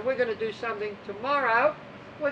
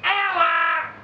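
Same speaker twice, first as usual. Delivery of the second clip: loud, higher shout of "Hour!"; yelled call; 6 semitones higher, 7 dB louder than usual. Retold or imitated. Coach Dirk shouting the end of the set, loud. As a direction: bark the time call across the pool. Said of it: "when Dirk yells out".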